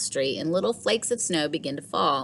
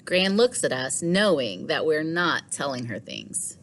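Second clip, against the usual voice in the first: usual speaking voice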